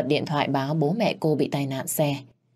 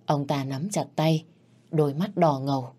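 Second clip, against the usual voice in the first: ordinary delivery, nothing marked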